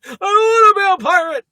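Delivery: high, loud wailed protest; Jerry Seinfeld. Acted. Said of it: "the, the Jerry's".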